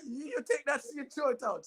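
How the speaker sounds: a furious mom waving away the C; outraged mother. Retold or imitated. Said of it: "She goes".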